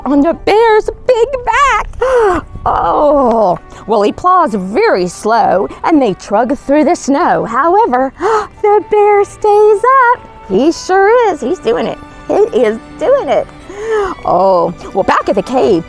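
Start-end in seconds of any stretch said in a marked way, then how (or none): none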